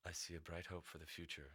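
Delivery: low mystical seer voice; fortune teller voice. Acted. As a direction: intone like a seer. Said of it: "getting into my character".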